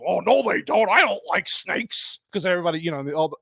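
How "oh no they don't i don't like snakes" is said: lightly voiced grumpy hero, slowing on the last line; a hint of a grumpy old adventurer; Harrison impression. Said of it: "And Harrison".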